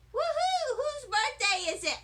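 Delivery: high, loud, thrilled whoop; excited shout. Acted. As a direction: yell with delight at the prize box. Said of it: "I shouted, very excited".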